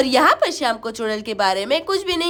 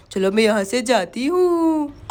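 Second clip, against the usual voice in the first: storybook witch; 5 semitones lower than usual. Acted. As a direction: draw the words out like a witch. Witch leaves. (चुड़ैल की धीमी, भारी आवाज़)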